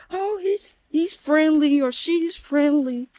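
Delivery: hammed-up owner voice; mocking pet owner. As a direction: parody a clueless dog owner.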